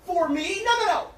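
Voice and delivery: shrill, loud, tearful string of no's; real tearful yelling at his partner; crying angry outburst. Real, not acted. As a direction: break down shouting no over and over. Heated argument, crying outburst.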